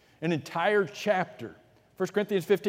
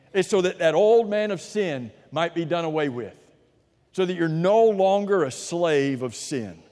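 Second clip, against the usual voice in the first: rising, louder preacher's build; fervent pulpit preaching; preaching at full force; 4 semitones higher, 4 dB louder than usual. Acted. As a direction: drive the point home to the congregation.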